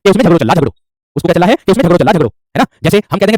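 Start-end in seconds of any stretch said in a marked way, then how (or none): 0.2-0.7 झगड़ो closed on a round "oh"; Braj ओ ending. Shown as a demonstration. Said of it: "ये ओकार इसको बोलते हैं". 1.7-2.3 झगड़ो with a final "oh"; Braj ओ ending. Shown as a demonstration. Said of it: "ऐसे इसको ओकार बोलते हैं".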